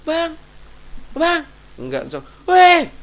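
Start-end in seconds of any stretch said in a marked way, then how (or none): 0.0-1.4 high-pitched call across a distance; short, raised calls for attention. Acted. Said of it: "Mau panggil". 2.4-2.9 high, very loud holler; full-voiced attention-grabbing shout; long-distance shout. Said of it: "jaraknya jauh karena rame".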